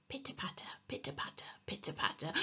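whispered, very soft sound words; hushed footsteps. A narrator's reading. Henry hears someone on the stairs, hushed whisper.

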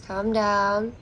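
ordinary talk

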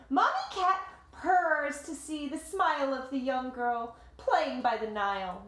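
story narration. A narrator's reading. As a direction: tell it like a picture book to kids. Reading the picture book aloud.

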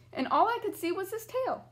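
plain reading voice

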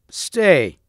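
one sudden high, falling call; sudden cry. A narrator's reading. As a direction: cry out to stop them. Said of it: "cried Sir Gawain suddenly".

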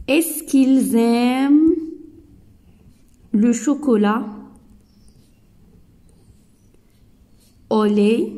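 slow rising question, est-ce qu'ils aiment le chocolat au lait; drawn-out question with a rising end; question read slowly. Shown as a demonstration. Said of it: "donc, on dit". (question lente et montante)

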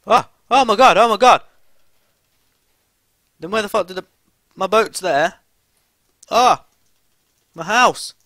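real high-pitched, very loud outburst; loud excited yelling. Real, not acted. Player suddenly finding the lost house, yelling loudly. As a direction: yell it out in sudden shock. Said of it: "Sorry if I was a bit loud then".

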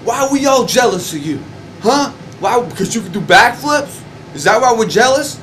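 high, jeering mimic of the other guy; retold taunt in a high mimicking voice; mocking Cable Guy. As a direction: throw his challenge back in a mocking high voice. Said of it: "And he goes to me".

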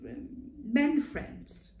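slow, bashful "men friends"; embarrassed hesitation. Acted. Clara embarrassed to say it to Ma, bashful. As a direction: trail off shyly over "men friends".

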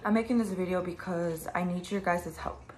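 ordinary talk, nothing marked

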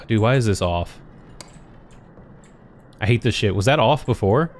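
normal talking voice

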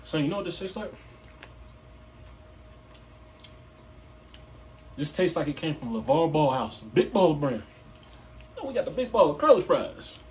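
chewing while talking; speaking between bites; mouth full. Real, not acted. Seen 1: Holds fries throughout, bites at 5.6, cheeks full near 8.

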